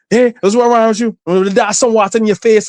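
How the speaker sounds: lightly voiced mother telling him off; mother scolding. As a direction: play the fussing Caribbean mom. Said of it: "she's like".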